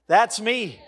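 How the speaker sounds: ordinary talk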